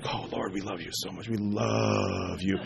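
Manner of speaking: mock emotional prayer. Preacher mocking emotional prayers, low and slow. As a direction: breathe it out like an over-emotional prayer. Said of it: "they want this emotional".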